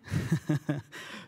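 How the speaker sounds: brief, breathy real chuckle; soft chuckle. Real, not acted. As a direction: chuckle lightly at your own aside. Laughs after his own aside.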